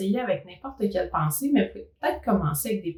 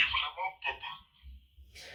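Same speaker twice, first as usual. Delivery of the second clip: chipmunk voice; 4 semitones lower, 11 dB quieter, much slower than usual. Shown as a demonstration. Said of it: "les [name]".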